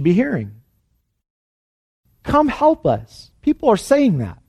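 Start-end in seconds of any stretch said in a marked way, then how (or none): 2.2-3.3 high, slow call for help; an appeal drawn out on a raised pitch; pleading call. Retold or imitated. Preacher voicing people who need the church, pleading.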